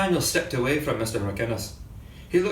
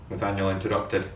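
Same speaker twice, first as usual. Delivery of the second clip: ordinary delivery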